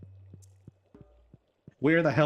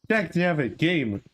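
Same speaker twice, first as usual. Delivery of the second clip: laughing curse, pitched up; half laughing, half cursing at the game; laughing frustration; 8 semitones higher than usual. Real, not acted.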